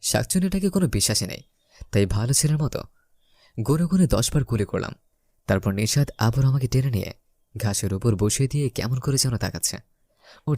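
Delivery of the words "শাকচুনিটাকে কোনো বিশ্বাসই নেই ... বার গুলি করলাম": ordinary delivery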